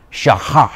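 "Shaha" said slowly as SHAH-hah, breathy h; Hebrew word demo. Shown as a demonstration. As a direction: teach "Shaha" clearly, stress on first syllable. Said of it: "Here's the first word I want us to learn".

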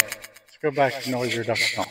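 ordinary talk

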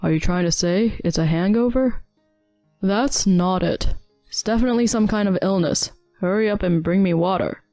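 lightly voiced irritated husband; irritated retort. A narrator's reading. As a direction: retort with mild annoyance. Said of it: "retorted in an irritated voice".

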